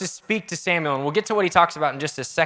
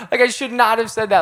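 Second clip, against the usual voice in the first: high-pitched, hammed-up self-reproach; exaggerated dismay, pitched up; mock regret; 7 semitones higher than usual. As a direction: play up instant regret at blurting something out. Acting regret after blurting something dumb.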